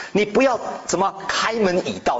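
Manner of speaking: usual speaking voice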